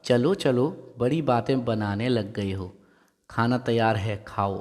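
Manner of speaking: ordinary talk, nothing marked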